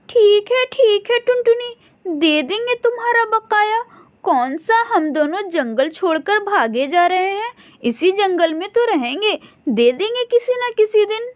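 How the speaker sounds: high, pleading crow wife; bright cartoon crow, pitched high. Crow couple voiced in story.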